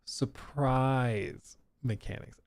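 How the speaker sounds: slow, scornful echo; mocking repeat. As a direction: drag out the phrase in disbelief. Mocking repeat of CEO phrase.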